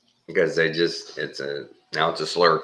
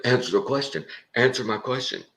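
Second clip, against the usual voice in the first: pushy mock demand, higher; interrupting debater; 8 semitones higher than usual. Rude YouTuber badgering the guest, mock pushy. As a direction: needle him with a higher insistent voice. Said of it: "he just, uh, interrupt".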